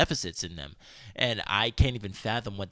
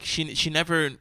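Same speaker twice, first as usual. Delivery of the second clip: ordinary delivery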